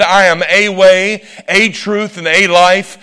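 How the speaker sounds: each "a" said as a stressed long "ay"; slow it down and lean on every "a"; emphatic "ay" for "a" before way, truth, life. Shown as a demonstration. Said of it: "if that verse had said".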